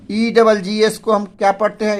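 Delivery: usual speaking voice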